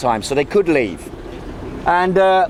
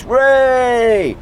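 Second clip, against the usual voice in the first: higher, bright hooray of a cheering crowd; mock crowd cheer; 5 semitones higher than usual. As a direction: cheer like two hundred thousand believers. Crowd cheers cosmonaut's promise.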